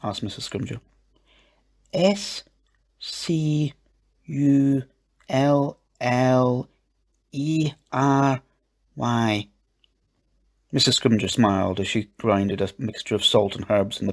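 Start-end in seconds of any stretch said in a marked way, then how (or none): 1.9-9.5 letter by letter, with gaps between; spelling out. A narrator's reading. Eliza spelling a word for Missus Scrimgeour, letter by letter. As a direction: spell it slowly in a higher voice. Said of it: "Can you spell it out".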